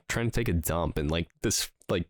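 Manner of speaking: ordinary delivery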